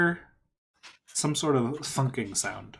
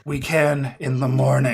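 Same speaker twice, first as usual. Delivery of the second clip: louder, firm gruff answer; gruff voice calling out; 7 dB louder than usual. Acted. Stranger putting off talks until morning, gruff call. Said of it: "You hear a gruff voice call out".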